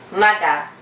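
normal talking voice

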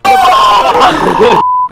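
very loud, high burst of laughter; shrieking laugh. Real, not acted. Player tells of going bald, shrieking laugh.

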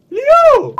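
loud high call; shouting a name. Real, not acted. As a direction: yell the name in dismay. Calling friend after crash.

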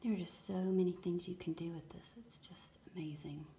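breathy hushed whisper of wonder; awed whisper. Real, not acted. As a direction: whisper it as if afraid to break the spell. Awed whisper over bath bomb.

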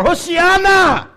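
a high, loud, drawn-out shout of praise; crying out. Acted. Preacher shouting the crowd's cry at the climax, loud and high. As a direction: lift it like the crowd calling to the Messiah.

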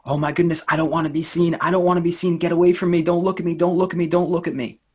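imitating a scared inner child crying don't look at me; panicked inner voice. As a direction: push everyone away in a rising panic.

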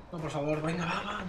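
very soft, low begging; hushed pleading. Real, not acted. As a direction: plead under your breath. Dreading the game will drop.

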